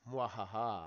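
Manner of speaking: mock evil laugh. Evil laugh after Patch Adams.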